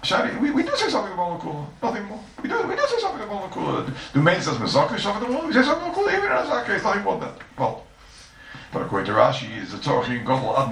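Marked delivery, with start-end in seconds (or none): 0.0-7.4 put-on high, singsong Yiddish-accented lilt. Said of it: "that is a Shani Yomer that we say".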